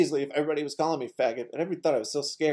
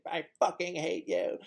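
mock toddler hate; 5 semitones higher, 5 dB quieter than usual. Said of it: "the way I would say".

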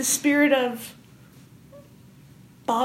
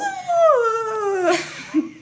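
high ghostly laugh; a rising spooky whoop; ghoulish whoop; 8 semitones higher than usual. Acted. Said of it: "That's my ghoulish noise".

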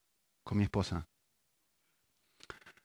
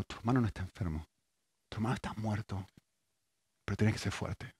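hushed grave news; 3 semitones lower, 8 dB quieter than usual. Retold or imitated. Father-in-law breaks news of death.

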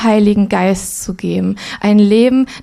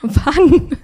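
high, slow, laughing disbelief; puzzled laughter at the neighbour's accusation; baffled laughing reply; 6 semitones higher, much slower than usual. Retold or imitated. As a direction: laugh out your confusion.

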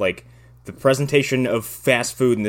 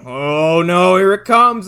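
mock dread; 8 semitones higher, 6 dB louder, slower than usual. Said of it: "I was like".

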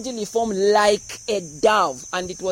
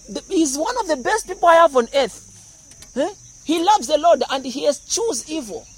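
proud God; 7 semitones higher, 4 dB louder than usual. Pretend. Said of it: "Michael was like".

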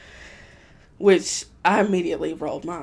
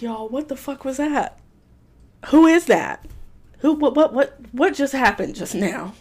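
incredulous questions; 5 semitones higher, 4 dB louder than usual. Retold or imitated. Asking clerks after he leaves.

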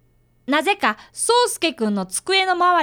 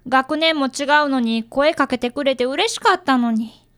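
ordinary delivery

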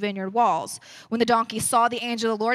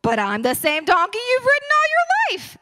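high, pleading donkey, much higher; 10 semitones higher, 3 dB louder than usual. Acted. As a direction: squeak it out as the wronged donkey. Donkey answers Balaam in story.

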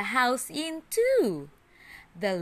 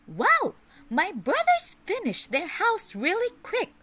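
much higher, bright piglet voice; little pig's voice; 10 semitones higher than usual. A narrator's reading. Little pig admiring his brothers' quick houses, high piglet voice. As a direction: squeak it out like a cheerful little pig. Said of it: "he said".

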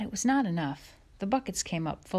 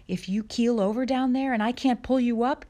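higher, louder anxious warning; mounting alarm; 6 semitones higher, 6 dB louder than usual. A narrator's reading. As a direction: warn him with panic building. Ma panics at the risk.

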